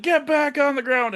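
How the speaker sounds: high-pitched mock yell; retold yell. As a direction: act out a yelled command. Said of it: "yells something".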